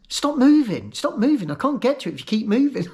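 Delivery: high-pitched imitation of an impatient nurse; retold scolding. Nurse orders a shaking patient to keep still, scolding.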